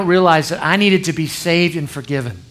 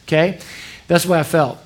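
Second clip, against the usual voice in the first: ordinary delivery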